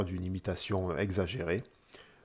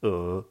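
E rising from mid pitch; "E" lifted like a question; second tone; 4 dB louder than usual. Shown as a demonstration. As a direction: let "E" climb steadily upward. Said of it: "Avec le son E".

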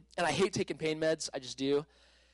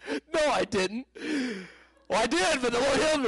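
excited protest; 8 semitones higher, 3 dB louder than usual. Retold or imitated. Contradicting doctor, excited testimony.

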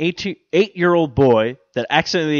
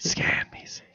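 whispering; 10 dB quieter than usual. Shown as a demonstration. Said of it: "I like saying it like that".

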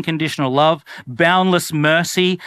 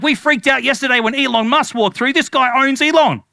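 higher, breathless excited gossip; mock freak-out; 6 semitones higher than usual. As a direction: worker stunned by the important visitor. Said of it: "workers of the house freaking out".

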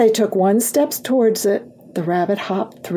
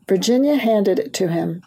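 ordinary delivery

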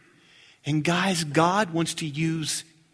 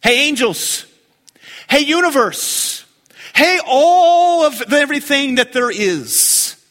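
high, loud call to the universe; booming divine address; God calling out; 6 semitones higher, 5 dB louder than usual. Acted. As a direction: proclaim it like God to all creation. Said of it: "God is trying to say this".

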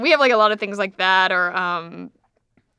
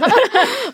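laugh; 12 semitones higher, 4 dB louder than usual. Real, not acted. Laughing recalling the Coke.

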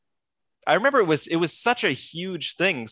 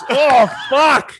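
loud, high, real yell of disgust; horrified outcry; 9 semitones higher, 9 dB louder than usual. Real, not acted. Reacting to the gory movie scene, appalled yell. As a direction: recoil and curse at full voice.

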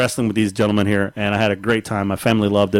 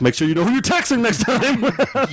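self-scolding; 11 semitones higher, slower than usual. Retold or imitated. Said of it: "And I'm like".

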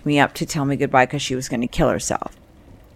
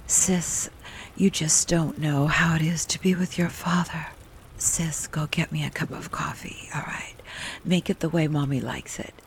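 a soft half-whisper, much quieter; mother whispering; 8 dB quieter than usual. Retold or imitated. Mother at night asking her daughter for coffee, whispered. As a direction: breathe it close, hushed and needy. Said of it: "she called me sis".

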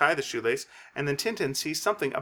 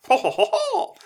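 flattered diva laugh; 4 dB louder than usual. Acted. Castafiore flattered, trilling laugh.

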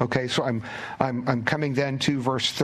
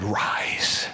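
low, whispered "Rise"; whispered command; 6 semitones lower than usual. Acted. Preacher voices Jesus whispering to the dead girl.

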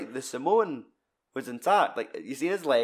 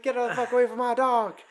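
angry dog owner; 11 semitones higher than usual. Retold or imitated. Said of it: "it's like".

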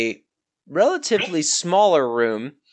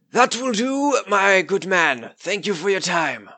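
higher, lordly dismissal; 7 semitones higher than usual. Acted. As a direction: dismiss the knight graciously.

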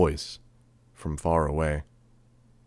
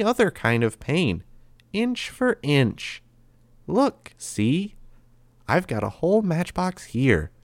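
put-on higher, lilting, sweetly menacing boy; creepy cheerful threat; 7 semitones higher, 3 dB louder than usual. A narrator's reading. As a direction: toy with the captives in a higher voice. Narrator voices Grey Boy threatening.

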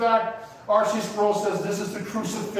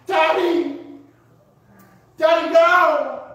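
high, loud grieving cry; reenacted wail; 8 semitones higher, 6 dB louder, slower than usual. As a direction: wail out for a dying father. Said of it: "I wailed".

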